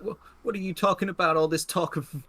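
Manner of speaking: high, stammering confusion; jittery gunman losing control; rattled young robber. Acted. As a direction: demand answers in a panicky high voice. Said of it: "and he's like".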